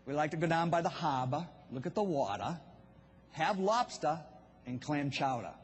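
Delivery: lightly done Boston accent showing off the dropped Rs; a casual hint of Boston accent, park the car as pahk the cah. Shown as a demonstration. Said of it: "if you have not picked up on my accent".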